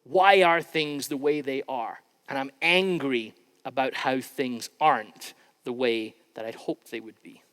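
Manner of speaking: ordinary delivery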